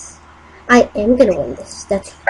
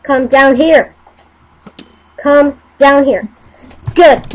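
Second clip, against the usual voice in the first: normal talking voice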